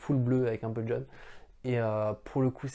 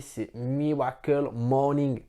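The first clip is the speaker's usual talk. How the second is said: Miracle Morning said slowly in a put-on English accent, r softened; 4 dB louder, much slower than usual. Shown as a demonstration. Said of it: "T'as vu cet accent anglais?".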